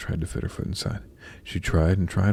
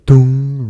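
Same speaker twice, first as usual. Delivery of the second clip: loud, booming "dong" held on one note; clock chime; 7 semitones higher, 12 dB louder than usual. A narrator's reading. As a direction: imitate a striking clock, ringing out the hum. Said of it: "rang the clock".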